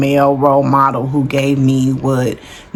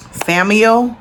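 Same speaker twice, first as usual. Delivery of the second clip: ordinary talk, nothing marked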